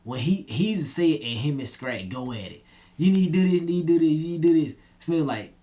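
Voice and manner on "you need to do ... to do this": higher, louder, rattled off fast and insistent; pushy nagging. Retold or imitated. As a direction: badger him over and over, speeding up. Scrag pushing Smith to do it.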